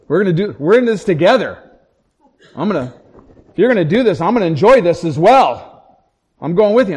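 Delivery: emphatic mock argument; insistent retelling. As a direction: dig in loudly, refusing to be left behind. Acted wife insisting on joining him.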